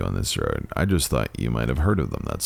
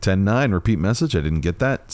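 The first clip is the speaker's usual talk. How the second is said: radio call; 8 semitones higher, 4 dB louder than usual. Acted.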